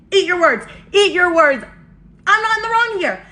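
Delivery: high-pitched, forceful vindication; triumphant retort. Retold or imitated. Storyteller shoving the watch at Melissa, indignant triumph. Retold triumphant confrontation with proof.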